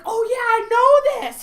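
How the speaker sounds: high, loud, drawn-out enthusiasm; all excited. Retold or imitated. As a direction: imitate someone thrilled to recognise it. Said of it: "He's, like, all excited".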